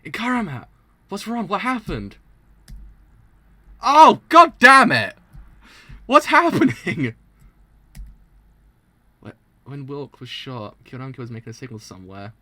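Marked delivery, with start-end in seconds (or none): 3.8-7.1 high, loud, slow cry of dismay; drawn-out loud wail, laughing into it; loud frustrated outburst. Real, not acted. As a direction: yell at the screen in dismay. Outburst at shocking scene.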